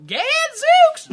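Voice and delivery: very high, loud whoop; hammy old-time exclamation. Acted. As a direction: proclaim it in a squawking mock-archaic voice. Town picks its new silly accent.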